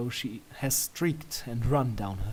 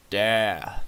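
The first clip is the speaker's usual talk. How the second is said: confident; 4 semitones lower, 4 dB louder than usual. A narrator's reading. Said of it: "you reply confidently".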